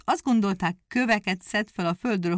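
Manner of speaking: ordinary delivery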